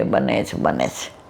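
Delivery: soft echo sinking low and dying out; fading echo. Shown as a demonstration. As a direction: whisper the refrain away to nothing. Refrain echoed, fading away.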